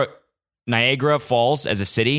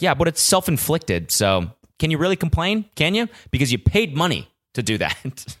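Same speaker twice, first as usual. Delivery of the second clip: higher, needling voice of a skeptic; mocking inner critic; 8 semitones higher than usual. Imagined critic mocks his marathon complaints, higher needling tone. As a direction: needle yourself like a smug critic. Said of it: "it's also like".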